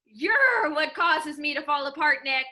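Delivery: ordinary talk, nothing marked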